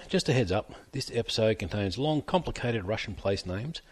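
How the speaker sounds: usual speaking voice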